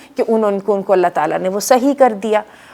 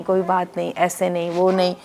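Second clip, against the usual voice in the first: کوئی بات نہیں said much softer, stress on کوئی; softer consoling; 2 semitones lower, 7 dB quieter than usual. Retold or imitated. Ayub soothing his complaining wife, soft and gentle.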